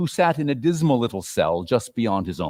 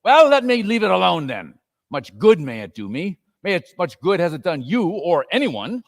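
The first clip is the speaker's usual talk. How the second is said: lightly voiced sour miser; grumpy Scrooge; 6 semitones higher than usual. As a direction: snap back dismissively. Scrooge retorts.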